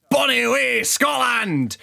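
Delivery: high, loud, slow mock-Scottish accent; bad Scottish accent. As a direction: put on a hammed-up Scottish accent, drawn out. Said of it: "which is a bad accent".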